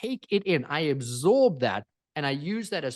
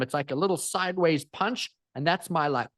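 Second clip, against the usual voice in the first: ordinary talk, nothing marked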